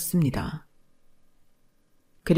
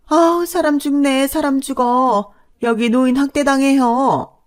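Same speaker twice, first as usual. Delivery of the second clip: high, loud wail for help; hysterical wailing; 9 semitones higher, 6 dB louder than usual. Acted. Mother-in-law wails to the neighbors that she is being abused, hysterically high. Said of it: "발악을 하기 시작하더군요".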